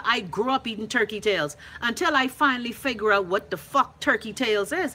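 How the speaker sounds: ordinary talk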